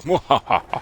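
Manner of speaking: sneering panto villain. Retold or imitated. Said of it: "and then he's like".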